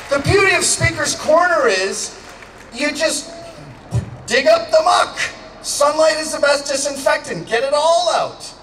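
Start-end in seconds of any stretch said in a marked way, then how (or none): none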